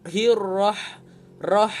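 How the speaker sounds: "rah" with a strong raspy breathy h; spicy ha. Shown as a demonstration. Teacher demonstrates the hot, spicy h sound. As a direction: hiss the h of "rah" like eating chili. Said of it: "Ha ini ha, ha pedas".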